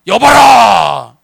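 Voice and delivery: slow drawn-out shout of a king; a king's booming proclamation; royal summons. Acted. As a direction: call the court to attention, long and loud. King calls out a decree.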